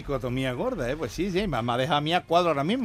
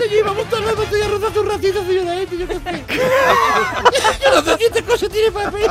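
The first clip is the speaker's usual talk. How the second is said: punchline squeaked out through laughter, high; can't hold the laugh while repeating it; 6 dB louder than usual. Real, not acted.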